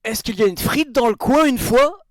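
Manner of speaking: put-on Belgian accent. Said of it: "l'accent belge".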